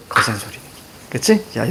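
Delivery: ordinary delivery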